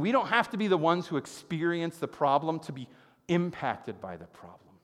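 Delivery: ordinary delivery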